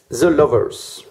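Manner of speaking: French speaker's attempt at an English accent. Shown as a demonstration. Tarot reader naming the fourth card, in an English accent. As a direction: announce it in a showy English accent. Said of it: "mon accent anglais est magnifique".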